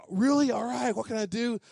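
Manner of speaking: retold kid's sudden excitement; eager kid. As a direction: imitate an eager kid jumping at the offer. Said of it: "Then I know they're excited".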